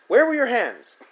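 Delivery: high, loud accusing question; angry demand. A narrator's reading. Sitter interrogating the boy again, loud angry demand. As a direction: hammer the question home. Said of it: "she says".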